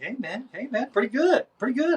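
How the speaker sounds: high, rising approving chatter; imitated riders. Two guys catching up to him, imitated praise. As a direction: mimic impressed guys in a high voice.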